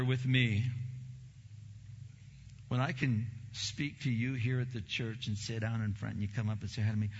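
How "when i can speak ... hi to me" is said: ordinary delivery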